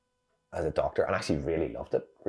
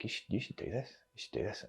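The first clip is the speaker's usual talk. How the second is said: quiet retold encouragement; 5 semitones higher, 14 dB quieter than usual. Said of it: "one of the other guys said".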